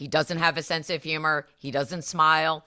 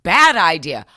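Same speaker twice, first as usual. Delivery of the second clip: much higher, louder scolding; mock correction; 6 semitones higher, 10 dB louder than usual. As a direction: scold the crowd like a fed-up adult. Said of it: "mock sane reply to crowd".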